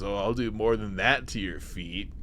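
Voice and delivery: low, soft mock seducer; lightly voiced suave reply; Riker. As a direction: drop low for a hammy come-on. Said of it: "And Riker goes".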